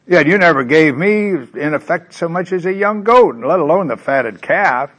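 high, loud aggrieved whine; older son whining at his father, high and loud; whining complaint. Acted. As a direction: complain like a resentful son, rising and pushing. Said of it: "Isn't that self-righteous?".

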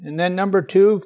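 ordinary delivery

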